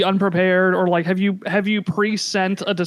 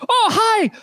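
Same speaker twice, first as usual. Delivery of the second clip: a much higher, bright false start at the captain's voice; wrong character voice; 12 semitones higher than usual. Acted. GM botches the CSI captain's voice before correcting it. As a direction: start the captain's greeting too high and wrong. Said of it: "that's the wrong accent".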